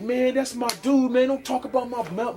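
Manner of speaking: a hint of an indignant onlooker; indignant crowd member. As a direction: mimic an offended heckler's friend. Retelling hostile crowd defending the heckler.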